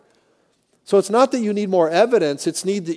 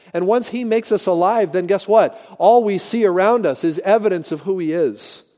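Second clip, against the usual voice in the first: ordinary talk, nothing marked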